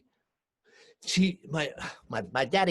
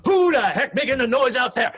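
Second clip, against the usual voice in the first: loud, higher call out the door; annoyed father shouting; 7 semitones higher, 11 dB louder than usual. Retold or imitated. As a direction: yell out to the yard, irritated. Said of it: "my daddy".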